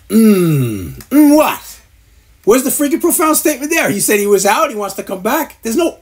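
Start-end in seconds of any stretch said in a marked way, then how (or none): 0.1-1.0 low drawn-out hum; mock profound hum. Mocking a host humming at a fighter's comeback, low hum. 1.1-3.9 incredulous outburst. Real, not acted. Ranting at podcasters.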